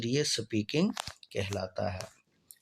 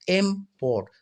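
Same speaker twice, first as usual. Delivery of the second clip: Import with the weight on इम, port said lightly; stress on first syllable; 5 semitones higher than usual. Shown as a demonstration. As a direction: lean hard on इम in import, ease off port. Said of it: "एम पर ज़्यादा जोर लगाया".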